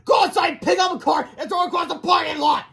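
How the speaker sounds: high, loud string of commands; shouted orders. A narrator's reading. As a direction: bellow each order at the top of the voice. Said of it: "Melvin shouted".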